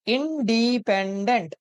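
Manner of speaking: "इंडिपेंडेंट" slowly, each syllable stretched; broken into syllables. Shown as a demonstration. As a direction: sound out "इंडिपेंडेंट" as in-dee-pen-dent. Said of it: "ऐसे read करते हैं ना". ("इंडिपेंडेंट" को टुकड़ों में खींचकर)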